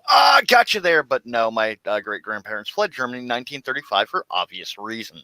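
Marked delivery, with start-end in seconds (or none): 0.1-1.0 high, loud mocking gotcha; mock gotcha shout. Mimicking people who think they caught him, mock shout. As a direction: shout it like you caught them out. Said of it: "That's where most people go".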